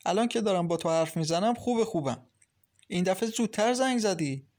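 bubbling over with joy on the phone; happy. A narrator's reading. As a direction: Maryam gushing happily to Masoud. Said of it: "مریم با خوشحالی گفت".

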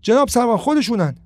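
high, loud shouting to the officer; accusing shout. Acted. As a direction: point and yell to get them arrested. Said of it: "فریاد زنان گفت".

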